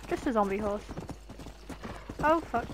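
ordinary delivery

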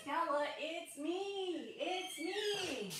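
high, slow sing-song call. Real, not acted. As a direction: call out to a pet. Calling to the dog on return.

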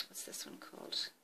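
very soft, whispered self-question; whispered aside. Real, not acted. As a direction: whisper it to yourself while checking. Checking a polish name to herself.

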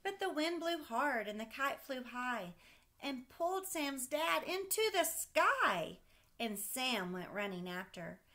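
put-on lively read-aloud voice for a child; storybook reading. Parent reading a kite story to a child, lively read-aloud. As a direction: read it like a bedtime picture book.

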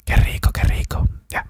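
gentle ASMR whisper. Acted. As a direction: pretend to eat into the mic, whispering. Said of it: "Hago como que estoy comiendo".

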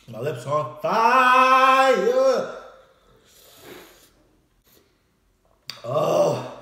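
high, slow scream of pain; burning mouth scream. Real, not acted. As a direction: wail as the spice burns.

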